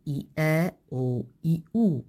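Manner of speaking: i-a ou i-u sounded out one vowel at a time, slowly; slow, separated vowel sounds i-a, i-u; vowels spelled out. Shown as a demonstration. Linguist sounds out the vowel pairs i-a and i-u for listeners. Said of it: "hesitação no que respeita à identificação".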